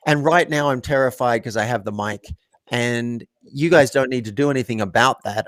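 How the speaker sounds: ordinary talk, nothing marked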